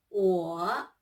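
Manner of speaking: 我 said low, dipping third tone. Shown as a demonstration. Teacher models 我 with a low dipping tone for students. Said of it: "third tone".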